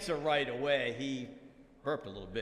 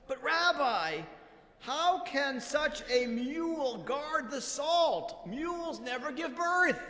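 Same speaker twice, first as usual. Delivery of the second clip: incredulous objection; 8 semitones higher, 3 dB louder than usual. Acted. Wise men challenge the rabbi's impossible answer, incredulous. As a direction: protest in disbelief, pitched well up.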